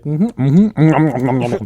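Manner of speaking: talking while eating; mouth full. Real, not acted. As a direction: speak through a mouthful of food. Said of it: "No, I'm just chewing".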